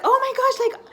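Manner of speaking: high, loud excited gush with a laugh; telling grandparents, high and loud; freaking out. Retold or imitated. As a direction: blurt the news, giddy and high. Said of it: "I started freaking out".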